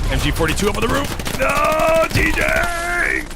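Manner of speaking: loud, high alarmed yell; shout. Real, not acted. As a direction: cry out as the player dies. Caster yells at player.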